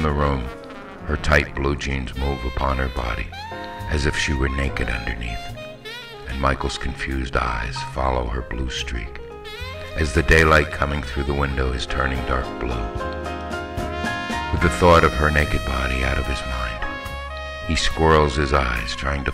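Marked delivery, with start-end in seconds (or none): none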